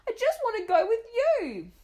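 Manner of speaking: very high, loud wail; girl pleading with her mother. Acted. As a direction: wail it like a tearful child. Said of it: "wailed Mona".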